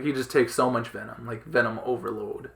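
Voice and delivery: usual speaking voice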